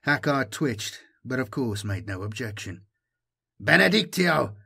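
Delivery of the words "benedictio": high, loud ringing benediction; a loud, higher blessing cry; called out loudly. Acted. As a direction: proclaim it loud to the gathered warriors. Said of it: "Sir Pilian called out loudly".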